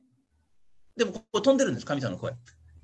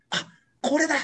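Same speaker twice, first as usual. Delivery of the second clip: sudden excited realization; 11 semitones higher than usual. Pretend. Paul gets peace and certainty.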